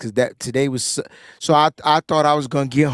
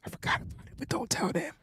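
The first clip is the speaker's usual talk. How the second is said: whispering; 9 semitones higher, 16 dB quieter, faster than usual. Real, not acted. Secret aside: don't tell them.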